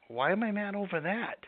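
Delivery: high, soft bemused question; amused realization. Retold or imitated. A person realizing they are mad over nothing, bemused. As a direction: catch yourself being silly, softly.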